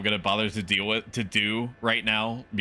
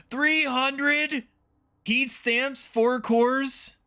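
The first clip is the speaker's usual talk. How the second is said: goofy high voice; 12 semitones higher, slower than usual. Acted. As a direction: squeak the numbers like a cartoon kid. Reading resources, goofy voice.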